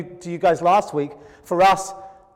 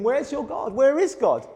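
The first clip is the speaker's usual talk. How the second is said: higher, taunting jeer; mocking enemies; 5 semitones higher than usual. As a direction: sneer at the believer in his misery. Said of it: "his enemies would say to him".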